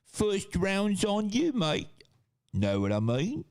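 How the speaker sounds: high, drawn-out British pub bloke; hammed-up British accent, ending in a laugh; mock Brit. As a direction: exaggerate a British mate's rising lilt. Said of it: "too generous to be an actual Brit".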